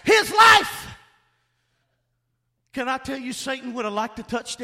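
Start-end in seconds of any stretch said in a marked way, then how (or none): 0.0-0.9 God's command. Retold or imitated. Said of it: "He said".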